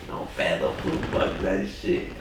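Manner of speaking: hushed, slow, scared backing off. Retold or imitated. Man at the door backing down from the knife guy, scared. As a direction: mutter it like you want no trouble. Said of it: "I said it scared".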